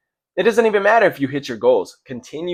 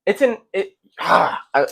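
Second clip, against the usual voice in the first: stumbling over the words; stuttering; 5 semitones higher than usual. Real, not acted. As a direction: stutter and restart. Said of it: "I'm stuttering bad".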